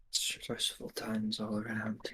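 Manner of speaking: slow, sighing whisper; weary whisper. Acted. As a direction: breathe it out, worn down. Character sighs, whispers wearily.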